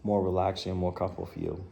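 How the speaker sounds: soft, measured meditation voice with long pauses; guided body scan. Real, not acted. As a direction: ease them down through the body, quietly and unhurriedly.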